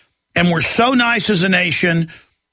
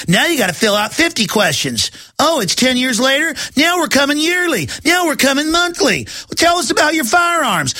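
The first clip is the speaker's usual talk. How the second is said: a hint of a smug official, pitched higher; mock bureaucrat; 8 semitones higher than usual. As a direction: mimic the census people piling on demands, higher and higher. Mocking census bureau's escalating demands.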